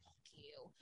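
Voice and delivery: unvoiced whisper; muttered whisper; whispered curse. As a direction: whisper the curse under your breath. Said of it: "I was like".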